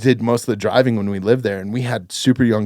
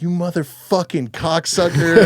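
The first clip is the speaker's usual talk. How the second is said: higher, slow mock yell; imitated angry yell; 7 semitones higher than usual. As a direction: drag out the insult in a retold yell. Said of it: "she's yelling at this dude".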